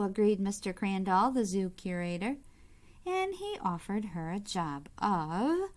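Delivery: bright, lively storybook reading; reading to children. A narrator's reading. Reading a picture book aloud to kids, lively storybook voice. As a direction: read it out to the little ones with gentle delight.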